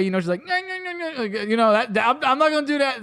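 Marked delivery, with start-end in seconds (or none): 0.4-1.2 high, soft wordless whine; repeated nasal-consonant noises, imitating someone unable to articulate; imitated inarticulate freak-out, pitched high; mimicked deaf vocalizing. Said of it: "in the deaf, the deaf way".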